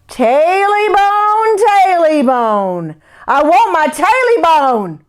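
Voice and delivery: slow, high, wailing ghost chant; eerie creature voice. Acted. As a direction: drag out each word like a haunting. Creature voice again.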